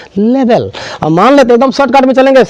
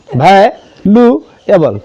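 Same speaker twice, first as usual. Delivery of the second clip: "Valuable" drawn out very slowly, syllable by syllable; slow syllable demo; 2 semitones lower, much slower than usual. Shown as a demonstration. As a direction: stretch valuable to show each syllable. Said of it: "यहाँ पर देखिए कौन सी syllable हो रहा है". (Valuable को बहुत धीरे, एक एक सिलेबल)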